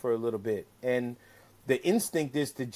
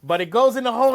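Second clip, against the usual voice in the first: ordinary talk, nothing marked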